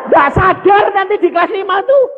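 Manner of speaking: high, loud comic outcry; shouted exclamation. Retold or imitated. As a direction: shout it high, all in one push. Exclaims over the coming trouble.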